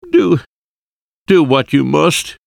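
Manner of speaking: high, broken acted sob; sobbing. A narrator's reading. Husband giving in to the professor, sobbing. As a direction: give in tearfully, voice lifted high. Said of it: "my husband sobbed".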